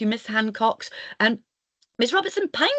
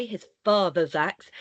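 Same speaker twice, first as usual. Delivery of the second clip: plain reading voice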